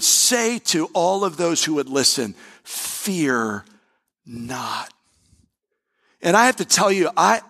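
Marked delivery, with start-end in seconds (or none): 2.6-4.9 low, soft, drawn-out "Fear not"; solemn hush. Acted. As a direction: let "Fear not" fall low and hushed like a divine word. Preacher quotes God solemnly.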